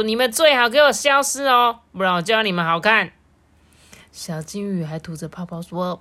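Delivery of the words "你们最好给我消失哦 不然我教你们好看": loud warning, quicker at the end; storybook farmer telling them off; scolding threat. A narrator's reading. Annoyed farmer threatens the friends.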